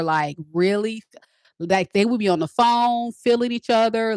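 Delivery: usual speaking voice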